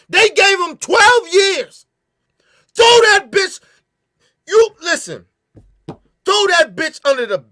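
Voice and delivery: high, loud enraged yelling; furious shout. Real, not acted. Outraged at short sentence.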